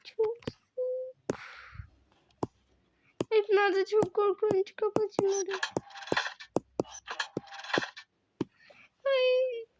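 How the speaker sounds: high, frightened and slow; a lost child, high and afraid; scared small voice. Acted.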